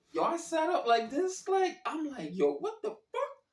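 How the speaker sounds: high startled yelp, far up in the voice; startled shock. Retold or imitated. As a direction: jolt up and yelp in alarm. Startled waking up to stranger.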